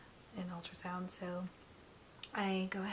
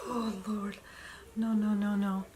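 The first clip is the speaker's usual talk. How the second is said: freaked-out imitation; 6 dB louder than usual. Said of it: "she was more freaked out than I was".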